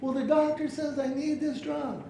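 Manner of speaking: very high, insistent protest; upset customer. Acted. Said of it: "he's upset, and he's mad".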